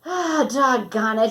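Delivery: mild dismayed exclamation; a boy's gentle "doggone it" at a swollen ankle; exclaiming. A narrator's reading. Boy dismayed at his swollen ankle, mild exclamation. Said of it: "he exclaimed".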